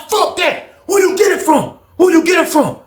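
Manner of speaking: high-pitched heated questioning; angry demand. Retold or imitated. Inmate demanding to know the source, angry and high. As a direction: act out a pressing, indignant interrogation. Retold quarrel over the drugs.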